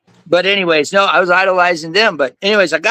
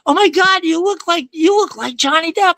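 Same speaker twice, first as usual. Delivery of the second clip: high-pitched starstruck tourist; mimicking an excited fan; gushing fan; 13 semitones higher than usual. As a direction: gasp it out like a thrilled fan, high.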